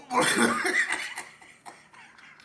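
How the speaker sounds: loud shrieking high laugh; explosive laughter. Real, not acted. Breaks up at own re-enactment.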